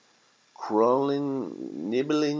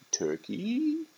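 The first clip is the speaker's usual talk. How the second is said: very high, rising questioning squeak; puzzled turkey; 3 dB quieter than usual. Acted. Turkey hearing the word turkey, high and puzzled.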